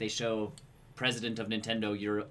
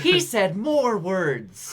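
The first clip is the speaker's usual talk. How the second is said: slow, loud mock complaint, higher pitch; complaining viewers; 7 semitones higher, 6 dB louder, much slower than usual. Podcaster imitating people objecting to the translation, slow loud mock protest. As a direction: drag out every word in hammed-up protest. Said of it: "when people are like".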